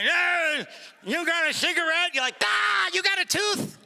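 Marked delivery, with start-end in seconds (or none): 0.0-2.1 rasping beggar call. Acted. Casino regular shouting at a leaving gambler for a cigarette. Casino zombie begging smoke. 2.4-3.8 mocking retort. Comic retorts to cigarette beggar.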